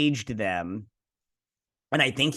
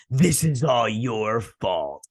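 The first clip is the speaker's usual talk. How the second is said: slow, drawn-out accusation; mock bad acting; much slower than usual. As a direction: imitate a poorly delivered dramatic line. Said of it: "he doesn't deliver it very well".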